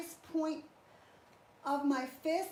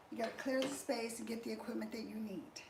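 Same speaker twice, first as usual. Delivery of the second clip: whispering; 4 semitones lower, 7 dB quieter, faster than usual. Real, not acted. Aside tip to viewers.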